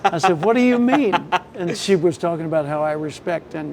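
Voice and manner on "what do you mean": high-pitched surprised question; mock puzzlement. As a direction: ask as if taken aback. Acts out surprised reply.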